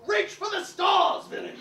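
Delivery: sudden battle shout. Acted. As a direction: leap into the attack yelling. Sudden attack cry.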